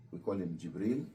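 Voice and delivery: ordinary talk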